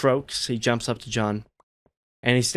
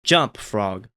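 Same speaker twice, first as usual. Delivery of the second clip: slightly anxious. Retold or imitated. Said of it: "with a little bit of an anxious timbre".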